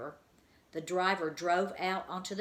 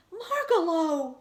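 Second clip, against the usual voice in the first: high, loud, long cry of the name; joyful cry; 12 semitones higher, 10 dB louder, slower than usual. A narrator's reading. Stuart in the garbage, seeing his friend arrive, a high joyful cry. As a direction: call out to her in sudden relief. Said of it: "cried Stuart".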